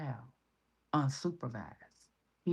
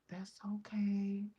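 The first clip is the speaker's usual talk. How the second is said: imitating a kind lady, whispered then higher; gentle reassuring lady; 6 semitones higher, slower than usual. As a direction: soothe like a kind stranger. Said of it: "She said".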